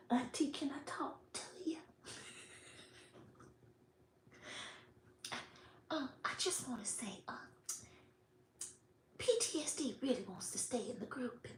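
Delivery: quick secretive whisper; sneaky hushed request at the door. Retold or imitated. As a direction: lean in and whisper it fast. Flint secretly asks at door.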